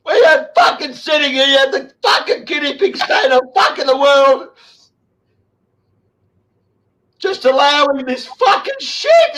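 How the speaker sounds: raging through tears; angry crying shout. Real, not acted. Ranting to the host about planes from China, crying in fury. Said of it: "I gotta control myself".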